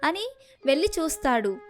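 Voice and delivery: ordinary delivery, nothing marked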